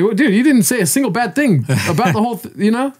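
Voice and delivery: high-pitched, incredulous retold objection; exasperated protest. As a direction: pitch it way up in disbelief. Retold protest at friend's harsh score.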